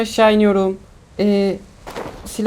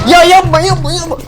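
panicked pleading; 8 semitones higher, 4 dB louder than usual. Real, not acted. Player being shot, pleads.

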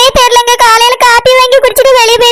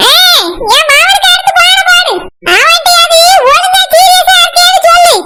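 higher-pitched nagging mother voice; higher, shouting old mother; scolding mother; 6 semitones higher than usual. Acted. Mother figure ordering.

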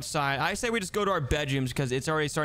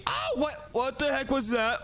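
high startled yelp, then drawn out; startled fright; 8 semitones higher, slower than usual. Pretend. Brother seeing scare on computer, frightened.

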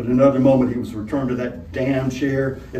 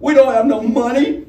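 put-on much higher, louder, scared little boy's voice; frightened schoolboy; 11 semitones higher, 6 dB louder than usual. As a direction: squeak it like a terrified schoolboy. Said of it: "as high as a frightened schoolboy's".

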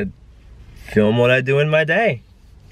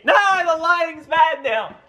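complaint shooting way up in pitch; high-pitched protest. Real, not acted. Vlogger complaining about bad lighting, high shouted protest. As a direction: cry out in a high, dismayed shout.

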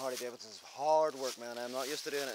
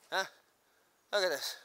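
normal talking voice

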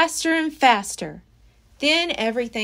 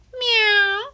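cat meow; 11 semitones higher, 4 dB louder than usual. Acted. Cat meows nearby.

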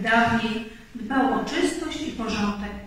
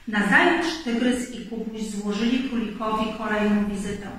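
ordinary delivery

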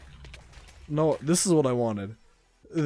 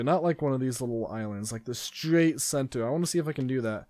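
usual speaking voice

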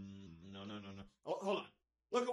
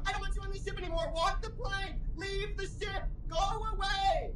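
building to a shout; 5 semitones higher than usual. Acted.

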